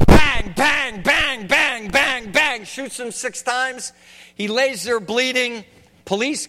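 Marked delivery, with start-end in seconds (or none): 0.1-2.6 loud, high, punched-out bangs; shouted gunshots. Retold or imitated. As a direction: fire off each word like a gunshot. Acting out six gunshots.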